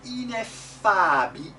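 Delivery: teacher's drawn-out "Ineffabi-"; model Italian vowels. Shown as a demonstration. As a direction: sound every vowel of "Ineffabi-" fully. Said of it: "they have good vowels".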